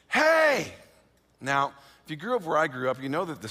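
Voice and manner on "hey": sharp high shout across the street; stranger yelling. Retold or imitated. Stranger across the street yelling at the narrator, loud shout. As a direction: call out loud to grab attention. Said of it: "yells at me".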